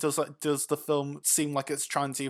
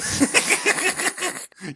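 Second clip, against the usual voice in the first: high burst of giggling; helpless laughter; 5 dB louder than usual. Real, not acted. Co-host cracking up at his friend's complaint, helpless laughter.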